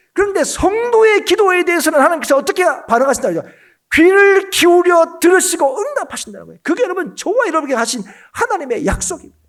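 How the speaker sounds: high, loud pulpit shout easing at the end; emphatic preaching. Real, not acted. Preacher assuring believers that God answers prayer, rousing proclamation. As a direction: press the promise home with full voice.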